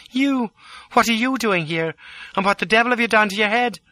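high, startled woman's voice; astonished exclamation. A narrator's reading. Lola to the man tumbling into her coach, exclaiming. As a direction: exclaim in disbelief at an intruder. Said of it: "she exclaimed".

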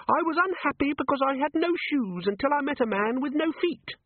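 very high, squeaky little voice; small creature piping out a motto; chirpy pocket imp. Acted. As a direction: recite it as a tiny imp.

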